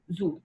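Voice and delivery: زو said softly as one syllable "zo"; pronunciation demo. Shown as a demonstration. Teacher explaining to pupils how to say zoo, softly. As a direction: say زو like the French zoo. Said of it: "فبنقولها او".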